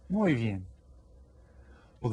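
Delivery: ordinary talk, nothing marked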